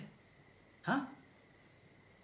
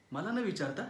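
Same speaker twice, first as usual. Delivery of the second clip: ordinary talk, nothing marked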